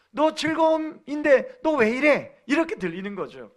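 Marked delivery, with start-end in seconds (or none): 0.1-2.4 slow, high taunting jeer; mocking. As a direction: tease her about her name. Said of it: "조롱하듯 들리는". (비웃는 듯 천천히)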